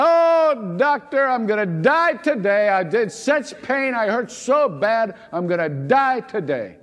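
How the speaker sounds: high, hammed-up wail of a complaining patient; moaning patient. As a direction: ham up the dying patient's moaning. Patient complains third day.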